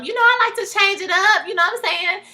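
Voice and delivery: high, airy mock impression of her voice; imitating her. Vlogger mimicking the woman answering about her hair. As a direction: pitch up to play her dodging. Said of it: "she was like".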